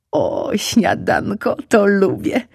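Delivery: slow, savouring cub voice; delighted fox cub. Acted. Hungry cub hearing of breakfast, delighted. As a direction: relish the word like a treat. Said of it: "pomyślał".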